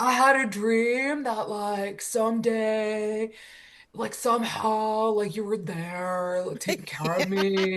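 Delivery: slow, spacey surfer dude. Retold or imitated. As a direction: drift through it like a dreamy surfer. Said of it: "has the craziest surfer voice".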